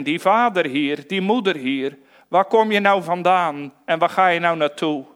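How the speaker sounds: ordinary talk, nothing marked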